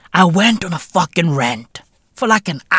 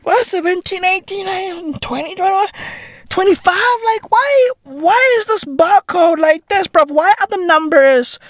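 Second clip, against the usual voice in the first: incredulous disbelief; 11 semitones higher than usual. Acted. Incredulous at numbers in sequence.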